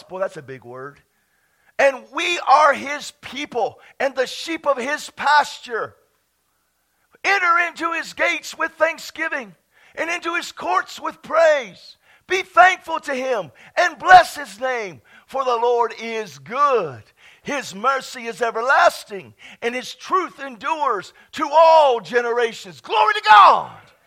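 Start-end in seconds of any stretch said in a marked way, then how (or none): 1.8-22.8 slow, loud scripture reading; declaiming the psalm aloud; preached scripture. Acted. Reads psalm with preaching force. 22.8-23.9 high, loud glory to God; a full-voiced shout of glory; shouted praise. Real, not acted.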